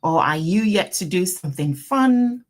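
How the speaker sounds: ordinary delivery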